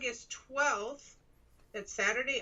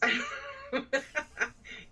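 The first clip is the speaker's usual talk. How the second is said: giggling; 5 semitones higher, 4 dB quieter than usual. Real, not acted. Laughing at odd voice.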